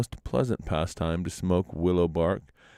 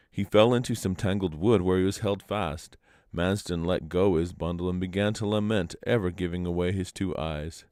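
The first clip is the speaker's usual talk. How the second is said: usual speaking voice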